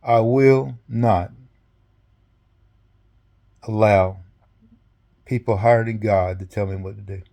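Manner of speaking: slow, deliberate, pausing between phrases; solemn resolve. Retold or imitated. General announcing his decision the next day, solemn resolve. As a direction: declare it gravely, word by word.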